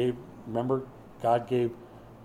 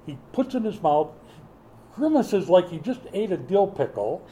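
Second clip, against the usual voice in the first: ordinary talk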